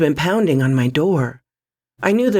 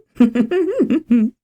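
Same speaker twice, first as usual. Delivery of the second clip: sarcastic laugh; 9 semitones higher than usual. A narrator's reading. Laughing at own joke.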